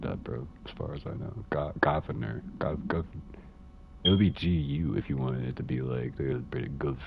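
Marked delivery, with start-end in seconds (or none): none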